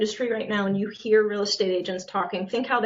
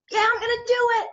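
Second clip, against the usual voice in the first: eager yes, pitched much higher; retold excitement; 11 semitones higher, faster than usual. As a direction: gush like a keen new agent. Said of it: "I'd be really excited and I'd say".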